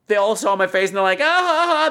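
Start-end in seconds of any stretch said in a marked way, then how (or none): 1.2-1.9 high mock group laugh; put-on high ha-ha laugh; imitated laughter. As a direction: imitate friends laughing at you. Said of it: "they're laughing".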